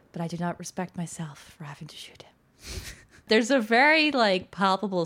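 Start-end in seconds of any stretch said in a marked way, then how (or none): 0.1-2.3 whispered regret. Retold or imitated. Retelling a soldier's quiet confession, whispered. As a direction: drop to a whisper for the regretful line.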